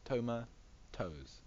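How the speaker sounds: "Toma", a pause, then "toes"; split word. Shown as a demonstration. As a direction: break "Toma toes" in half with a gap. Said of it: "Toma and then toes".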